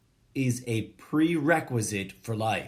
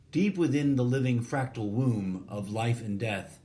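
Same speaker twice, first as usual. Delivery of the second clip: usual speaking voice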